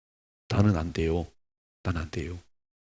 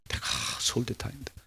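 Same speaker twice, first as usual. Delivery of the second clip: bragging; 5 dB quieter than usual. Retold or imitated. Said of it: "막 이렇게 막".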